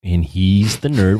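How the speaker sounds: slow, over-enunciated delivery; slow, drawn-out. Retold or imitated. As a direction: echo a slow introduction. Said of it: "Why do you say it so slow?".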